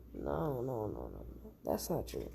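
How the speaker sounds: refusing to hear it, barely audible; hushed denial. Acted. Bakugo refuses the terrible news.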